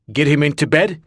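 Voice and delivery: normal talking voice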